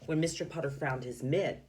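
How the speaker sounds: usual speaking voice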